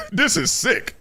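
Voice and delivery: ordinary talk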